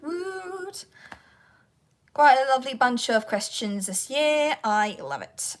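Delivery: normal talking voice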